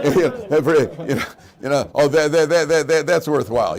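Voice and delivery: laughing through the words, loud and high; real laughter bubbling through the line. Real, not acted. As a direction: crack yourself up at the joke. Laughing mock enthusiasm.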